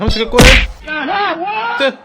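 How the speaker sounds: high, slow, loud frustrated cry; frustrated yell. Real, not acted. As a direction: yell it out in exasperation at the game. Frustrated gamer yelling at game. (صرخة غضب عالية وبطيئة)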